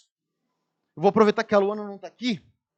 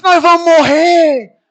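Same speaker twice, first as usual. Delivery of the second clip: louder, higher, slow panicked yell; retold yell; 5 semitones higher, 9 dB louder, slower than usual. Another youth on the mountain yelling in fake panic. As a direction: yell it as if death is near. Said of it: "outro gritou assim".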